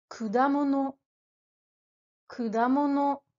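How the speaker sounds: kudamono, each syllable even and clear; Japanese kudamono. Shown as a demonstration. Teacher modelling the word for the learner, clear Japanese. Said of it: "Répète après moi".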